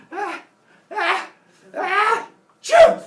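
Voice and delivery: rising gasps into an explosive shouted sneeze; building fake sneeze. Kronos sneezing over the baby, huge hammed-up sneeze.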